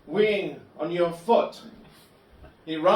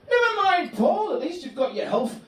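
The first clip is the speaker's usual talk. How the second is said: cheery friend Gary; 10 semitones higher than usual. Retold or imitated. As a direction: mimic an annoyingly happy Buddhist friend. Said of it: "And he'd say".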